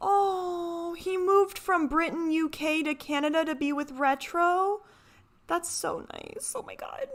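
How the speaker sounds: high gushing coo that fades into a whispered oh my God; high, delighted gushing. Real, not acted. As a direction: melt over how sweet it is. Gushing over couple moving together.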